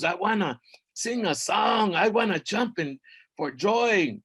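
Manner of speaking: high, eager skeleton lord fed up with silence; a high, restless death god longing to rattle his bones; giddy high underworld lord; lord of the dead. Acted. Mictlán Tecuhtli speaks.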